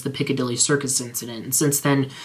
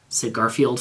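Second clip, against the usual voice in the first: usual speaking voice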